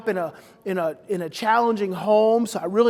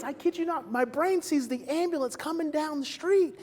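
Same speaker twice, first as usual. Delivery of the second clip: ordinary talk, nothing marked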